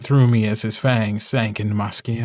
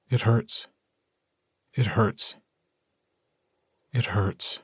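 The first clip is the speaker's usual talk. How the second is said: soft, sinking, defeated voice; quiet hurt; 7 dB quieter than usual. Acted. Rejected wolf grieves his loss, soft and defeated.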